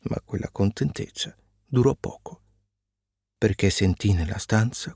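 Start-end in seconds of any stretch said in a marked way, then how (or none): none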